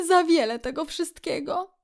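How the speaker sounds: high-pitched, overjoyed protest; delighted cry. A narrator's reading. Kret overwhelmed by all the food, delighted cry. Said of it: "wołał zachwycony Kret".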